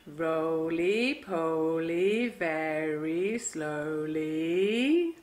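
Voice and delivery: low, drawn-out roly poly; very slowly. Shown as a demonstration. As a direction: draw every word out very slowly.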